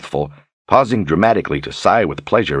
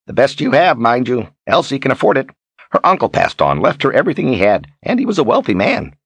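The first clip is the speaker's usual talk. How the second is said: higher, boastful storybook character voice; Zed, talkative old traveler; 8 semitones higher than usual. Acted. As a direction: play Zed talking up his companion.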